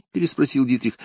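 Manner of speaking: ordinary delivery, nothing marked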